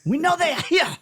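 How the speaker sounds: high, loud fake German; German accent. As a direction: bark it like a hammy German officer. Mock German raid voices.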